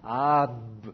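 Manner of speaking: "Ah, b" with the lips shut fully on the b; closed-lip b demo. Shown as a demonstration. Teacher shows students the lips closing on a stop b. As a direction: close the lips hard on the "b" after "Ah". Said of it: "je dois aller fermer mes lèvres".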